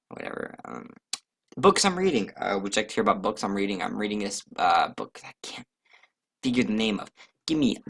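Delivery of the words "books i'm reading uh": ordinary talk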